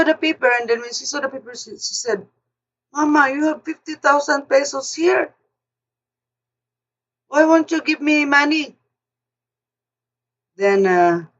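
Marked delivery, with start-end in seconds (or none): 2.9-8.7 higher-pitched imitation of a pleading daughter-in-law; lightly voiced demanding young woman, pitched up; daughter-in-law asking.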